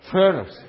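Foreigners called out loud as fur-ners; Southern pronunciation. Shown as a demonstration. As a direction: lead the crowd in saying Foreigners as fur-ners. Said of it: "Can you say that with me?".

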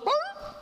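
very high imitated dog yelp. Dog answering the evangelist's rebuke, high imitated yelp. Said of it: "the dog goes".

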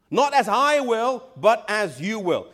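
slow it down and lean on each word; emphatic slow quote. Shown as a demonstration. Preacher drilling the congregation on Jesus' words, slow and stressed. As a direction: stress on Not and as, said slowly. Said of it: "repeats Jesus' words".

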